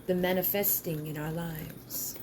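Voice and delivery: soft whisper inside the chant. Acted. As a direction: breathe it as a secret. Whispered line within spell.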